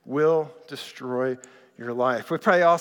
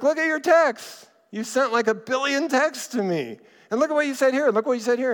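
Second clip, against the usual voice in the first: much higher-pitched, insistent imitated wife; exasperated wife; 9 semitones higher, 3 dB louder than usual. Wife confronting husband over his texts, exasperated imitation. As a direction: argue back high and insistent, imitating her. Wife arguing back, retold quarrel.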